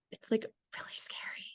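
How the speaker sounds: soft unvoiced whisper. Real, not acted. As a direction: drop to a whisper, confiding. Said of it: "whispered confession".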